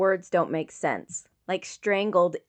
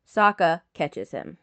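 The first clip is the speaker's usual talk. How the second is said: ordinary delivery, nothing marked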